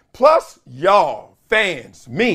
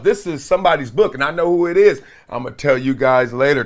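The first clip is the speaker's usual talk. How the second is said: ordinary talk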